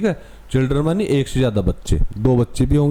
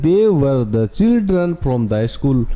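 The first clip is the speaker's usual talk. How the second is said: very slow, word by word; slow read-aloud; much slower than usual. Shown as a demonstration. Teacher reading the text to students, very slow. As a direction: read the line out slowly. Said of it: "तो ये कह रहे हैं".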